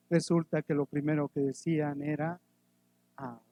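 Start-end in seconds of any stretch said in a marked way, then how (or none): none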